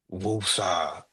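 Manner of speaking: ordinary delivery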